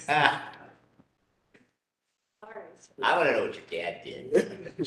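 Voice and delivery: high, laughing words through giggles; can barely get it out for laughing. Real, not acted. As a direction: asks while still laughing. Listener laughing at story.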